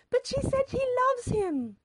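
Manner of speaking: very high, loud, slow wail; mother's cry. A narrator's reading. Mother protesting the broken match, crying out. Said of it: "Mother cried".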